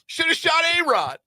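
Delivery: very high, loud quoted outburst; imitated movie yelling. Host quoting a comedy's yelling at Mark Wahlberg, high mock yell. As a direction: yell it like an outraged movie line. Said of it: "yelling at Mark Wahlberg".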